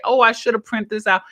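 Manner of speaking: usual speaking voice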